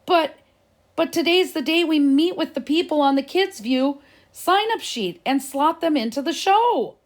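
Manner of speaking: high pleading protest; horrified protest. A narrator's reading. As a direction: protest in dismay. Benji was horrified.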